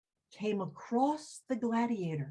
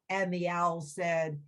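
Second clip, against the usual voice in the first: usual speaking voice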